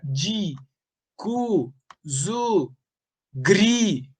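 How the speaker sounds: "di, cu, zu, gri" as separate beats; stressed syllables isolated. Shown as a demonstration. Teacher lists where the count stops, "di, cu, zu, gri" detached. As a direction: drop each syllable singly, with pauses between. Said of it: "Eu paro sempre na última sílaba tônica".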